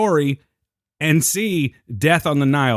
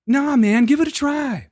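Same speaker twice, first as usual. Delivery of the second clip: higher, airy coaxing teacher voice; mock guru coaxing; 11 semitones higher than usual. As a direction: nudge the reluctant husband like a gentle guru. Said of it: "do we take a class to talk this way".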